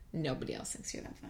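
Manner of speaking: soft unvoiced jab; whispering. Real, not acted. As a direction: whisper the put-down.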